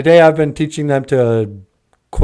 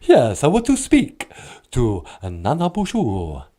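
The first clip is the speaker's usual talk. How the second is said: theatrical Transylvanian vampire caller; grand puppet-vampire accent, pitch swooping down; Count Von Count. Acted. Count asking for the host by phone, hammy vampire voice. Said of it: "Count Von Count".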